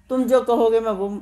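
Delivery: usual speaking voice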